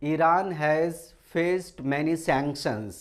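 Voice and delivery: each word read out very slowly; slow dictation. Shown as a demonstration. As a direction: spell it out slowly for students to copy. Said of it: "हम sentence बनाना चाहें तो कैसे बनाएंगे". (बहुत धीरे, शब्द-शब्द बोलकर)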